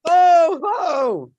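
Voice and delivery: loud, high ho ho chant; jolly ho ho ho. Shown as a demonstration. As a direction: call out ho ho. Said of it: "repeat after me".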